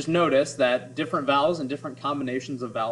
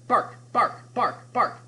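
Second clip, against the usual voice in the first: dog barking; 9 semitones higher, much slower than usual. Shown as a demonstration. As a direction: bark like a little dog. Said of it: "making the sound of a dog".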